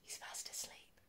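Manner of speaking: faint whisper. A narrator's reading. Reader hushing children as hare sleeps, whispered. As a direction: barely breathe it. Bedtime hush.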